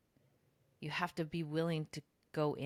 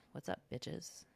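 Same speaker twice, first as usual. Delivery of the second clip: confident swagger; 6 dB quieter than usual. Retold or imitated. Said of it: "And now I'm just like".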